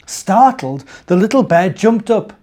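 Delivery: gentle storybook narration; reading to children. A narrator's reading. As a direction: read it like a bedtime story.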